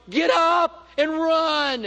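high, loud, drawn-out slow-motion yell; will the fallen runner back onto his feet; urging shout. Pretend. Audience urging the fallen runner, drawn-out shout.

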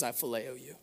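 a softly spoken humbled reply; humbled, quiet. Acted. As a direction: say it small, humbled and honest. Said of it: "he's been humbled".

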